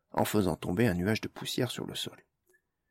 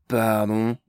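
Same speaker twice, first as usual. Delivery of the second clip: growled; 6 dB louder than usual. A narrator's reading. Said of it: "gronda Zarfolk".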